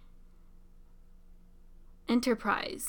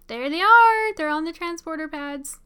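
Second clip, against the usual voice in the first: excited; 5 semitones higher, 5 dB louder than usual. Real, not acted. Crew beamed back aboard.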